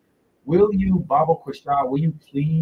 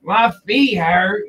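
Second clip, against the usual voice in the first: mock whiny churchgoer; 6 semitones higher, 5 dB louder, much slower than usual. Repeats the mock complaint.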